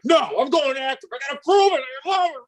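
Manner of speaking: high, loud, stubborn insisting; hammed-up teen bravado, yelled; mock shout. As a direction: shout it like a cocky kid who won't listen. Retold teen arguing with dad.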